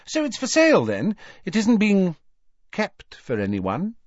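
high, eager questioning; feigned innocence. A narrator's reading. Hermione fishing for what is reserved, feigned innocence. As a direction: probe brightly with a very high voice.

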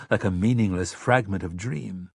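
ordinary delivery, nothing marked

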